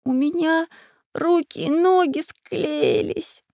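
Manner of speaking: put-on high, drawn-out groaning boy; suffering whine. A narrator's reading. Boy stuck fast in sticky goo, whining. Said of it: "мучился Чижик".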